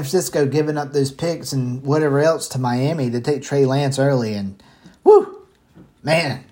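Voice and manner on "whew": whew. Real, not acted. Exasperated at the trade.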